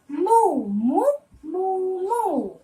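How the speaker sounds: playful cow moo. Shown as a demonstration. Teacher imitates cow in poem.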